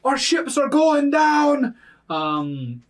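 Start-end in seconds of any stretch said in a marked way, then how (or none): 0.0-1.8 high, loud, slow orc shout; yelling orc. Acted. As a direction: bellow in panic as an orc. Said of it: "orcs yelling".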